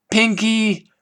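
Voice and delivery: high, strained cry with the air half crushed out; squeezed wheeze. Acted. Rainbow crushed in Pinkie's hug, wheezing her name high. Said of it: "Rainbow wheezed".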